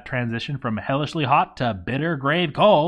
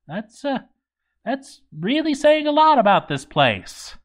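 incredulous; 11 semitones higher than usual. Real, not acted. Disbelief at goblins fearing the fort.

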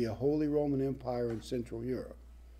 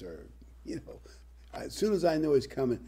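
usual speaking voice

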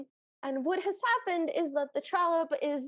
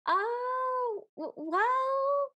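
high, drawn-out hesitant croon; mock-vague, high and lingering; mimicked dithering prince; 10 semitones higher, much slower than usual. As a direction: stretch each word up high, stammering into the second. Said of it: "and he'll go".

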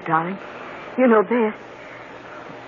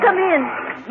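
calling out; 10 semitones higher than usual. Acted. Greets the detective at the door.

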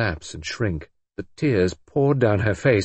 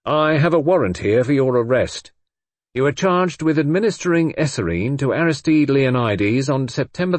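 louder, raised, formal police caution; curt official voice; 5 semitones higher, 4 dB louder than usual. A narrator's reading. Inspector arresting Brenda with official phrases, curt and formal. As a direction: read the charge like an officer on duty. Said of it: "He spoke curtly, in his official voice".

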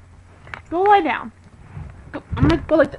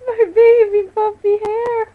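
slow, higher, louder whining wail; mock whimper; 8 semitones higher, 14 dB louder, slower than usual. Owner mock-wails about her messed-up hair. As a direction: whine over ruined hair.

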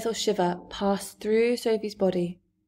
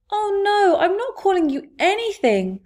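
much higher and louder, rushing to make amends; anxious cry; 11 semitones higher, 7 dB louder than usual. A narrator's reading. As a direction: cry out quickly so he won't leave. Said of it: "Sophie cried".

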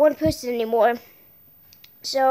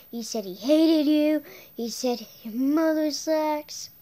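ordinary talk, nothing marked

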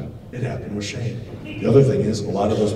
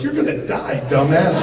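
high, loud mocking shout; loud mock scolding; mock yell; 7 semitones higher, 7 dB louder than usual. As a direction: bark it at him like an exasperated medic. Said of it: "They're like".

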